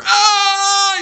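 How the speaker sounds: high, loud sudden shriek; hammed-up scream of panic; panicked scream. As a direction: burst out screaming. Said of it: "He's screaming".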